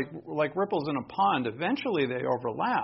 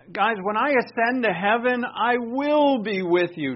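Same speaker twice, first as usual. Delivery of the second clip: mock wrong version; 7 semitones higher, 7 dB louder than usual. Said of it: "No, he doesn't say that".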